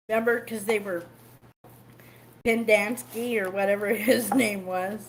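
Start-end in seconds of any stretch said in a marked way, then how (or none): none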